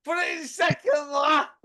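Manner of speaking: hammed-up shout from the kerb; mock shout. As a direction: bellow it like an impatient passenger. Retelling shouted driving instructions.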